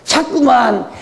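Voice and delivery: mock warning of punishment; mocking other preachers. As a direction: parody a preacher who scares the flock. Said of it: "이걸 안 가르치고".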